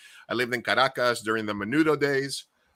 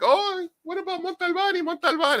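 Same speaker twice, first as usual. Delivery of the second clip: imitating childhood talk, very high voice; high-pitched childhood quote. Retelling an imaginary-friend story from childhood, in a high put-on voice.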